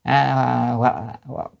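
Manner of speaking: normal talking voice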